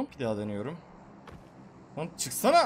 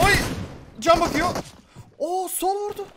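much higher, loud excited yells; real frantic gamer outburst; high excited yelling during a firefight; excited shouting; 11 semitones higher, 3 dB louder, slower than usual. Real, not acted. Frantic in-game firefight reaction.

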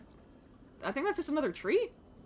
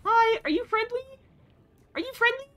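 much higher, gentle cooing; sweet baby talk; 11 semitones higher than usual. Pretend. Streamer asking the alien creature sweetly in a high voice.

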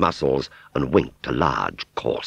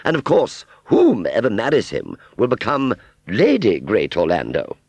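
high, loud, grandly savoured; self-important aunt; 7 semitones higher, 5 dB louder than usual. Acted. Aunt Sally imagining marrying strong man, self-important. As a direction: announce your hopes with swanky pride.

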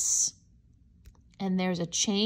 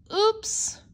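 high, louder mock alarm; playful surprise; 9 semitones higher, 4 dB louder than usual. Reader pretending to lose Corduroy in front of children, playful surprise. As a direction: pretend you've lost him.

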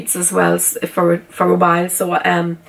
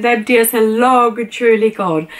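ordinary talk

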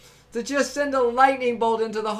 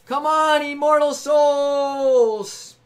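rallying shout, drawn out; calling out; 3 semitones higher, much slower than usual. Acted. Streamer rallying his whole channel, calling out. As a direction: call out to every soul.

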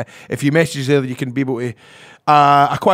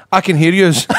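louder, higher retold comeback; acted-out loud interjection; retold call-out; 4 semitones higher, 8 dB louder than usual. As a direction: call it across to the couple, raised voice. Confronts the overheard couple in story.

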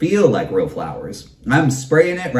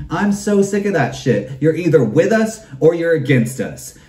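ordinary delivery

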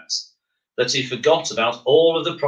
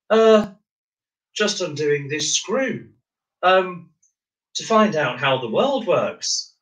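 unsure; 7 semitones higher than usual. Acted. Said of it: "not quite sure where the voice".

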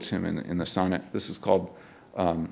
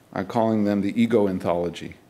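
ordinary delivery

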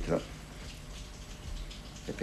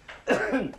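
a sharp real cough. Real, not acted. Smoker coughing on the phone to Erminia, real cough. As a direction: cough hard from the cigarette. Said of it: "perché ho la tosse".

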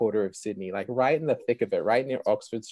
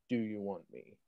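soft, very straight question; quoting God's voice, quiet and direct; 10 dB quieter than usual. Retold or imitated. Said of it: "Very straight".

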